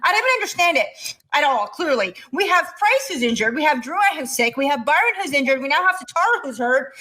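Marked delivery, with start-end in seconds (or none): none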